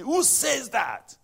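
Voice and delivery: high, drawn-out challenge; challenging doubter. Acted. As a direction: demand to know on whose authority. Voices a doubter challenging scripture.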